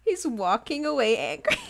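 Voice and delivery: ordinary talk, nothing marked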